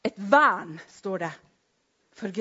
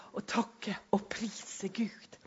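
whispered praise; 5 dB quieter, slower than usual. Real, not acted. Soft aside in worship.

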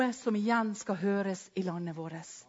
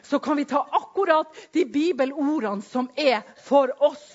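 loud, high, driving sermon voice; fiery preaching; 6 semitones higher, 9 dB louder than usual. Acted. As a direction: preach it out at full force. Preaching at full force. (forkynnende preken med full kraft)

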